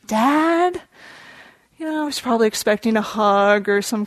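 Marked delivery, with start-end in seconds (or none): 0.1-0.8 mock-puzzled kid, pitched up; quizzical. Puzzled son questions his father's odd advice, quizzical. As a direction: question Dad in a higher voice. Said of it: "with a quizzical look on his face".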